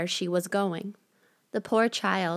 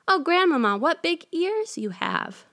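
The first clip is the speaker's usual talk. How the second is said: put-on 1 surprised girl; 10 semitones higher than usual. A narrator's reading.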